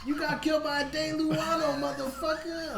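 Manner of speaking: high, slow, laughing mock yell; movie quote. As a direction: belt out the movie line, cracking up. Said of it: "that car in fucking Pineapple Express".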